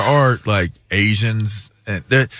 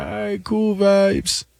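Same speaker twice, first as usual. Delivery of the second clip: slow, higher put-on Jamaican; mock Jamaican reply; 9 semitones higher, slower than usual. Answering the pilot in a slow, high mock Jamaican accent. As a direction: play along in a Jamaican accent, slowly. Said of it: "I'm like".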